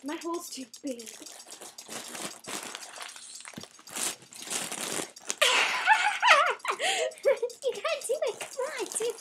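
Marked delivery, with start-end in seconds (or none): 1.1-7.6 helium giggle. Real, not acted. 7.6-9.1 helium voice. Real, not acted. Urging after inhaling helium.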